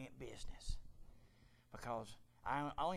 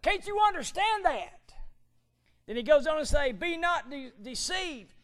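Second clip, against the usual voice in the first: high, loud fire-and-brimstone preaching; full-force preaching; 11 semitones higher, 12 dB louder than usual. Acted. Preacher thundering Paul's warning at the church, loud and high. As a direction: thunder the scripture at the congregation.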